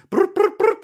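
high, playful brr trill; playful lip trill. Pretend. Playful sign-off noise.

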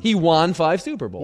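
"won" insisted on as wahn, rhyming with John; odd pronunciation of won. Shown as a demonstration. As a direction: hold to "won" with an open ah vowel. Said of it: "That is a pronunciation I've never heard".

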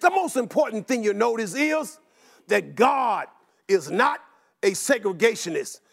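ordinary delivery

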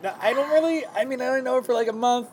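high-pitched, mock defensive suitor; Juan Pablo. Suitor excusing himself to the host, high mock protest. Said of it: "And he's like".

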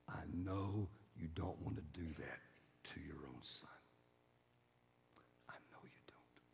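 low, hushed reassurance sinking to whisper; soft, tender, fading to breath; calm mother. Retold or imitated. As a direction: gently appeal to a husband's heart. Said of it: "the mom just very calmly steps in front of him".

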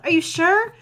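high, loud frightened yell. Acted. Unicorn yells doubt at soldier during shelling. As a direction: yell back in panic. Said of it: "Twilight yelled".

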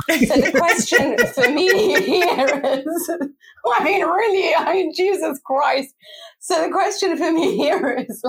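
high, helpless laugh through the words. Real, not acted. Co-host trying to get the question out, laughing.

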